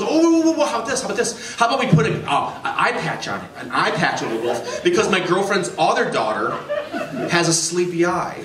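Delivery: blurting out a new idea in a rush; excited shout. Retold or imitated. Tattoo customer pitching an eye patch to the artist, giddy and rushed. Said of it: "and goes".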